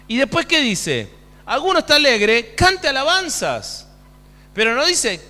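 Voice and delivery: high, loud pulpit exhortation; forceful preaching. Real, not acted. Preacher urges the afflicted to pray, loud high exhortation. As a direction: call out the instruction to the whole church.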